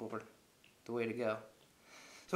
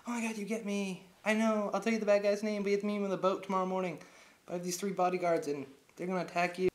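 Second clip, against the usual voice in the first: higher, louder gushing imitation; pitched-up girlish put-on; mock woman's voice; 7 semitones higher, 4 dB louder than usual. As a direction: play the woman spilling her secrets. Voicing woman at bar.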